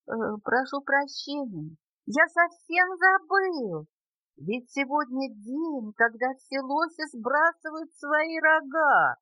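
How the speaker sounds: slow, high-pitched storybook apology; apologetic moose. A narrator's reading. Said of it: "извинился лось".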